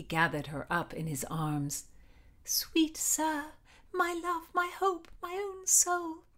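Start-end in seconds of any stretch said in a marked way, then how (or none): 2.4-6.2 high, lilting lovestruck voice; adoring heroine. A narrator's reading. Heroine in her lover's arms, high adoring voice. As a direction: breathe out endearments high and tenderly.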